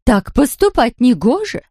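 stern queen voice. Acted. Queen scolding.

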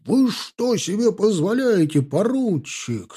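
high, indignant officer's cry; scandalised, drawing out the last word; outraged yelp. A narrator's reading. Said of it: "завопил гвардеец".

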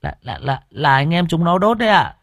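put-on scared child. A narrator's reading. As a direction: stammer out the blame like a scared kid. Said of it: "thấy ông Nghĩa nổi giận thì sợ lắm". (giọng trẻ con sợ hãi, lắp bắp)

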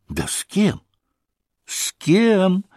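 exclaiming. Acted. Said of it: "Воскликнула Лизе".

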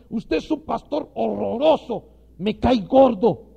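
hammed-up scornful woman; mock insulting woman. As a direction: sneer it out slowly, loud and high. Acted woman insulting pastor.

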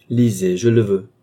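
insist, lay down the order; firm command. A narrator's reading. Balsamo orders the medium to read, firmly. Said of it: "d'un ton plus impératif encore".

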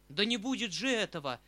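uncle's defiant outburst; stamping outcry. Acted. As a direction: shout it down with a stamp. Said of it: "вскрикнул дядя, топнув ногою".

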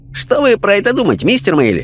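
high-pitched, playful questioning voice; Chinese spirit guide. Acted. Chinese guide winking at the sitters, high and playful. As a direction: ask lightly, pitched right up. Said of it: "китаец подмигнул им".

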